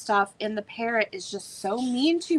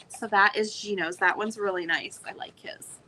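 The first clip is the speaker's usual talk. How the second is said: usual speaking voice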